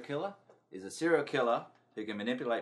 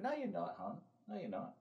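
much higher, coy dismissive voice; hammed-up woman waving off a threat; mock woman's reply; 3 semitones higher, 9 dB quieter than usual. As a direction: play a brushed-off lover, sing-song. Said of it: "And I went".

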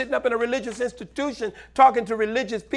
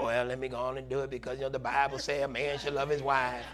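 lower, softer grudging mutter; grudging duty voice; 8 semitones lower, 10 dB quieter than usual. Pretend. Pastor mocks a husband serving from duty, grudging mutter. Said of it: "It's not like".

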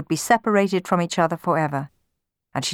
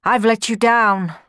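louder, higher, wretched admission; miserable; 5 semitones higher, 5 dB louder than usual. A narrator's reading. Ashamed Orpheus to Amor, miserable confession. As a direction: own up to it miserably. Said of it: "he asked miserably".

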